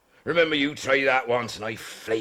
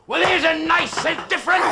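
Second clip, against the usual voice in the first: much higher, loud enraged yell; furious shout; 6 dB louder than usual. Acted. Skipper attacking blackmailer, furious high shout. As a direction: roar it as you lunge.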